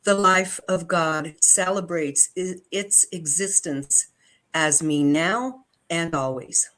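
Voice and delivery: normal talking voice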